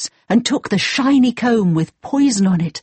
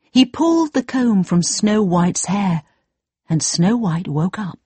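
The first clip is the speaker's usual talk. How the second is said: ordinary delivery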